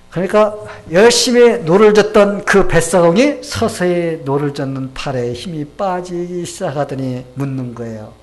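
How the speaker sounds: usual speaking voice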